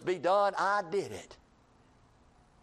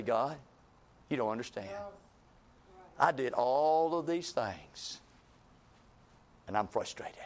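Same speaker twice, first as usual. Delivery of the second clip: weary complaint; 3 dB quieter than usual. Acted. Voicing the believer's complaint.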